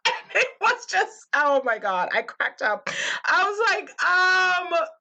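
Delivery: laughing helplessly while talking; cracking up. Real, not acted. Cracks up retelling the moment.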